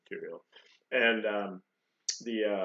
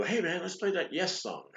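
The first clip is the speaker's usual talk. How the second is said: higher-pitched hammed-up request; mock eager jammer; 10 semitones higher than usual. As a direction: play an imagined jam buddy piping up. Acting out an unlikely jam request.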